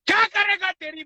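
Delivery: real angry high-pitched cry; furious taunt. Real, not acted. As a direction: throw the challenge out high and furious. Enraged taunt at a rival.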